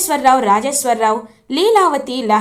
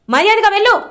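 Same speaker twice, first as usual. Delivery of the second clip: high, loud command to leave; shouted order; 7 semitones higher, 4 dB louder, faster than usual. Acted. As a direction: bark the order at her. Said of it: "గట్టిగా అరుస్తారు ఆనంద".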